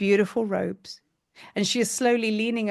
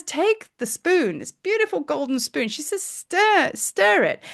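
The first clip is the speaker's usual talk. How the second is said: higher, coaxing goddess voice; 6 semitones higher than usual. Acted. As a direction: urge them to stir, lifting higher. Goddess hands over spoon.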